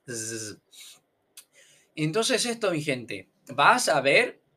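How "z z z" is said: soft sustained "Z" buzz. Shown as a demonstration. Teacher isolating the Z sound, buzzing. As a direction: hum a quiet "Z" through close teeth. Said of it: "Los dientes se quedan cerquita".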